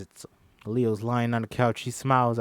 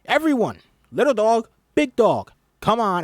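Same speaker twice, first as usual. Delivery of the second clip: high, loud class command; teacher directing the class; commanding call; 10 semitones higher, 7 dB louder than usual. Acted. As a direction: order everyone to join.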